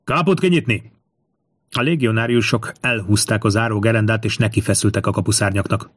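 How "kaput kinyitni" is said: high, drawn military cry; shouted command. A narrator's reading. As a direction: call out the order to the gate. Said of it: "elkiáltotta magát".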